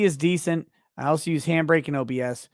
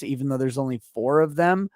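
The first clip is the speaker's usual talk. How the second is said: ordinary delivery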